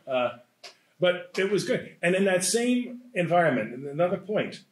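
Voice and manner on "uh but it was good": usual speaking voice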